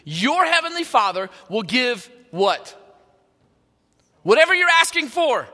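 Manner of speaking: loud, high preaching declaration; emphatic call-out. Acted. Preacher proclaiming to the congregation, loud and high. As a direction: build to a ringing answer.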